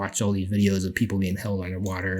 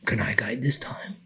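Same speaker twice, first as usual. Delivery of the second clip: whispered, softer ask; whispered request; 7 dB quieter than usual. Retold or imitated. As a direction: ask shyly under your breath. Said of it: "he's like".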